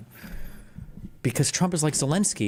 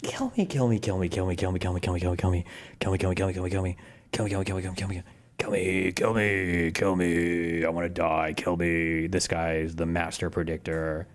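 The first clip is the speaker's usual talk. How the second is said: low, droning repeated plea; mock despair; 9 semitones lower, 4 dB quieter than usual. Streamer despairing at the master predictor, mock despair. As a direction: chant it low on one note, like a mantra.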